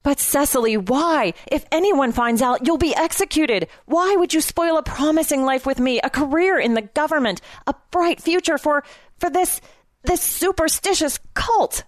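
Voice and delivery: high, urgent, climbing to outrage; agitated pleading. A narrator's reading. He begs her not to spoil their life, agitated pleading. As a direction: plead hard, pitch climbing to the last word.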